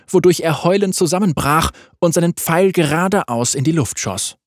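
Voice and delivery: ordinary delivery, nothing marked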